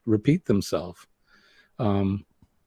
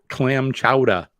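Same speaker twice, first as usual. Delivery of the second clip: thick New England "chowda", the final r dropped; New England accent; 2 semitones higher, 3 dB louder, slower than usual. Shown as a demonstration. Host showing the thick local accent, New England accent. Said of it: "people in New England have a very thick accent".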